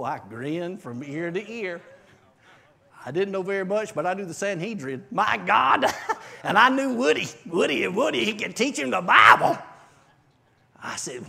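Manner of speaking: ordinary talk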